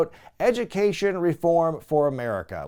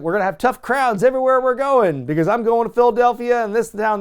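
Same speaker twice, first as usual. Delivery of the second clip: louder, higher campaign-rally delivery; speech-giving politician; 6 semitones higher, 4 dB louder than usual. Retold or imitated. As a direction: blast out the campaign pitch. Voicing Sharpton's campaign speech.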